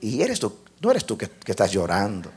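low, soft voice of God; quiet divine questioning; God speaking. Retold or imitated. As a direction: ask gently, low and hushed. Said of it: "Y Dios me dice".

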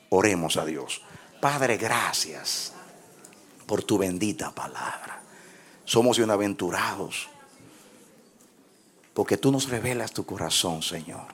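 prayer. Acted. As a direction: pray gently and earnestly. Leads closing prayer.

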